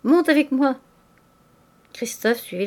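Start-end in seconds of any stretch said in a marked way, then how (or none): 0.0-0.8 raised, louder summons; calling out. A narrator's reading. Old lodger ordering the servant upstairs, calling out. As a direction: call him up to you. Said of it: "cria le père Goriot".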